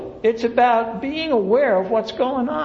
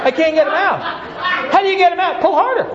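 mock struggle; 6 semitones higher, 3 dB louder, faster than usual. Newcomer struggling, Ken coaxing.

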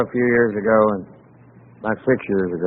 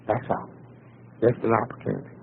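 much softer, near-whisper close; hushed emphasis; 4 semitones lower, 13 dB quieter than usual. Real, not acted. Speaker ending his point to the room, hushed. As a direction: let it fall quiet for weight. Soft closing point to audience.

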